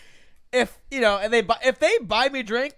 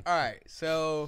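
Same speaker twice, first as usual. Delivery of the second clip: low, slow interview voice; deliberate low mock negotiation; imitated job candidate; 5 semitones lower, 3 dB quieter, slower than usual. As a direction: play a laid-back applicant laying out terms. Acts Stan at job interview.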